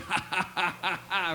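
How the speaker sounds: imitated villain's laugh in separate beats; measured mock-horror "ha ha ha". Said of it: "have the Thriller laugh".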